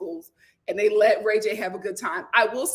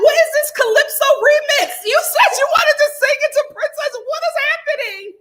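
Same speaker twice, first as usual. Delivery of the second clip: loud, much higher outraged disbelief; incredulous shout; 9 dB louder than usual. Retold or imitated. Reacting to [name]'s remix, loud incredulous yell. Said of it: "I was like".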